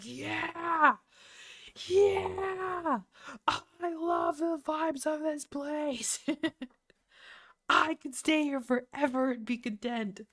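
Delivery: high, loud gushing cheer; drawn-out mock squeal of delight; hammed-up elation. Fan reliving the vibes of the concert, hammed-up elation. Acts out delight at crowd's outfits.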